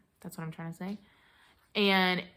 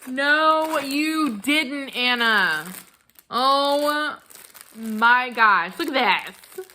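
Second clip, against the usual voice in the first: loud, high squeals of touched surprise; overwhelmed with delight; 7 semitones higher, 7 dB louder, slower than usual. Real, not acted. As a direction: gush loudly, high and close to happy tears. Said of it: "I wanna cry".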